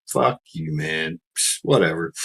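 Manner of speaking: soft, dismissive smirking mutter; smirking attitude. Retold or imitated. Driver smirking at the trooper, soft and dismissive. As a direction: brush him off under your breath. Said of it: "got this smirk on my face".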